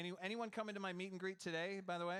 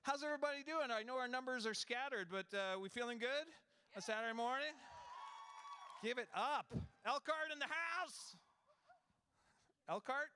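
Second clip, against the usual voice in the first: hyping the crowd; 9 semitones higher than usual. Real, not acted. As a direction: pump up the audience like a host.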